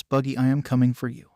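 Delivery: usual speaking voice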